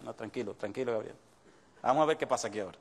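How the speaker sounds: a hint of a calm, fatherly God. God calms Gabriel.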